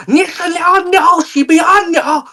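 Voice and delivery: very high, drawn-out fake Chinese; shout-across-the-kitchen mock Chinese; hammed-up imitation of Chinese speech. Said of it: "they're like".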